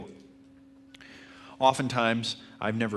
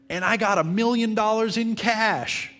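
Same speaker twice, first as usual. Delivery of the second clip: much higher and louder, shouted like a big bold line; reading a copywriter's bold punchline with full energy; bold headline read out; 12 semitones higher, 7 dB louder than usual. Shown as a demonstration. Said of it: "in big, bold letters he'd put".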